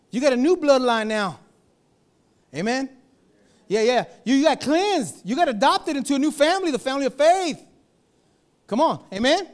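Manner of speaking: loud, high, driving preacher's call; full-force preaching. Acted. Preacher rallying his congregation, loud and high. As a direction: lift the church with a shouted blessing.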